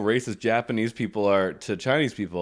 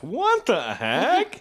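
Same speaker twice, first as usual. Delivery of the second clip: very drawn-out, high exclamation; wrong mock astonishment; 10 semitones higher, much slower than usual. Cohost attempting the fun-fact reaction, overdone astonishment. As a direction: overdo it, slow and high, swooping up. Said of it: "it's not like that".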